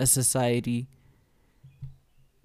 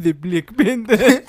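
loud, slow, high mock African accent; butchered African accent; 6 semitones higher, 9 dB louder, slower than usual. Mocking Forrest's butchered accent, loud African accent. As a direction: overdo a botched African accent, laughing. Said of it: "you butchered that accent".

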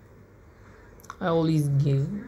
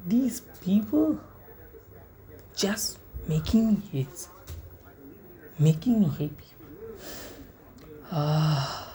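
ordinary talk

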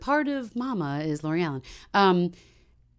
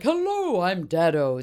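cartoon dad voice; 5 dB louder, slower than usual. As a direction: greet like a silly cartoon father. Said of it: "Tom was like".

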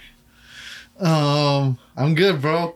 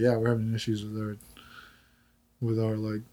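bored reply; 6 semitones lower, 7 dB quieter than usual. Retold or imitated. Worker quoting own reply.

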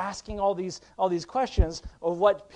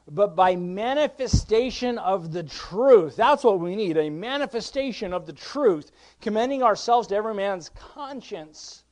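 raised, emphatic sermon voice; forceful preaching; 4 semitones higher, 6 dB louder, slower than usual. Real, not acted. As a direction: drive the scripture home to the congregation.

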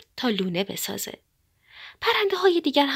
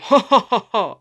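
forced laugh; 7 dB louder than usual. A narrator's reading. Woodpecker orders everyone to laugh.

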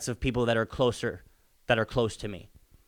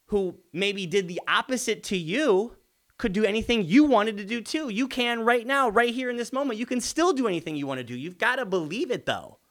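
fired-up motivational push; 8 semitones higher than usual. Acted. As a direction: drive it home like a coach rallying the room. Passionate pep talk to parents.

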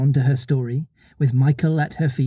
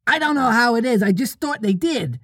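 put-on high boy bursting in; excited little-boy interruption; 10 semitones higher than usual. A narrator's reading. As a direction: pitch it up, tripping over the first words. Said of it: "Curly broke in excitedly".

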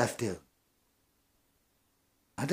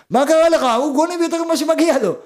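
indignant boss; 10 semitones higher, 6 dB louder, faster than usual. Acted. Indignant worker's boss quoted.

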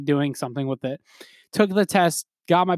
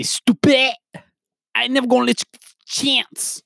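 high-pitched mock rapper yelling; six nine; 9 semitones higher, 3 dB louder than usual. Imitating a wild rapper's song, six nine impression. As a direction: squeal it like a hammed-up rap taunt. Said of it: "from a New York accent to six nine".

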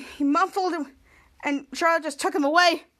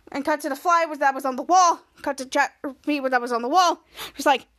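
usual speaking voice

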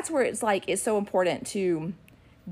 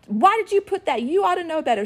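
higher, louder mock scolding; fussing; 10 semitones higher, 4 dB louder than usual. Big kids fussing at poor younger girl, scolding. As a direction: imitate big kids piling on with reproach. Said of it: "all these big kids are just fussing".

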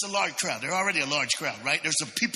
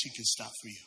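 usual speaking voice

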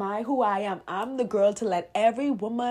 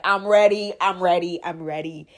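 building to ready; 10 dB louder than usual. Retold or imitated. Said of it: "I was just like".

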